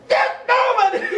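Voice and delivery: high, loud drill-sergeant bark through laughter; barked order. Retold or imitated. Sergeant grabs rifle, barks order.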